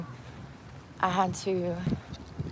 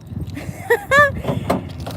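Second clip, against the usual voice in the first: high breathy laugh; 5 dB louder than usual. Real, not acted. Laugh after mocking clients.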